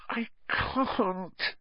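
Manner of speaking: high, tearful whimper; helpless, soft high-pitched cry; anguished refusal. A narrator's reading. Harry refusing the elf's demand, anguished.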